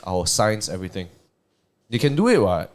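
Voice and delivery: ordinary talk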